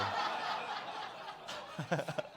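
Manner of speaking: soft breathy chuckle at his own joke; soft laugh. Real, not acted. Preacher laughing at his own teasing of a congregant.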